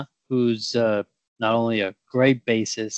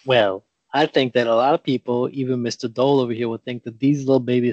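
usual speaking voice

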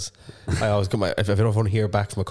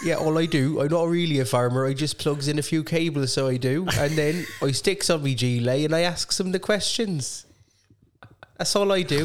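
mock West Country farmer; 7 semitones higher than usual. Quizmaster plays a farmer to his friend, mock Devon accent. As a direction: ramble like a simple Devon farmer about plugging in cables. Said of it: "You should just do a full quiz like that".

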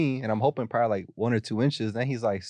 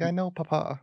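mock child; 9 semitones higher than usual. Kid reply to uncle.

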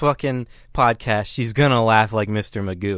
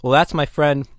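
ordinary delivery

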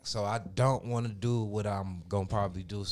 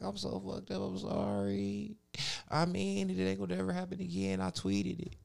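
mocking her apology; 11 semitones higher than usual. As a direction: imitate the drunk friend apologising. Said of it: "She was like".

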